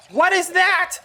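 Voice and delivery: very high, loud cry of shock; shocked shriek. Real, not acted. Player spotting something by a sunken ship, shocked shriek. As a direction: shriek it in astonishment. Spots something strange underwater.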